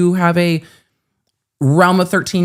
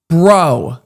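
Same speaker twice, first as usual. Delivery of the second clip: retold exasperation; 4 dB louder than usual. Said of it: "I'm like".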